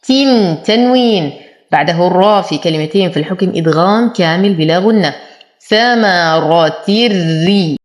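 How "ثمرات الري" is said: slow ثمرات merged into the ra; recited idgham. Shown as a demonstration. Teacher models full merging without ghunna. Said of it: "أرقق الراء".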